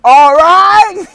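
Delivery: sudden high, loud bark of a shout; sheriff's yell. Retold or imitated. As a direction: blast it out like a cop startling you. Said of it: "he just yells".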